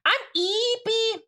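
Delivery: high, drawn-out cutesy introduction; playful high voice. Pretend. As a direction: stretch it out in a high, put-on voice. Repeats greeting in playful high voice.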